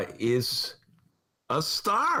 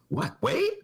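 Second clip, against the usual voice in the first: sudden high squeaky "Wait?" jumping way up; startled double take, high rising finish; Pat Whalen imitation; 12 semitones higher than usual. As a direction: play dumb, then shoot the pitch up on the second word. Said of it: "That's my Pat Whalen imitation".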